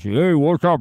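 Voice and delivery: hammed-up puking DJ greeting; puking radio voice. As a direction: belt it out like an over-the-top radio jock. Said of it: "that puking voice".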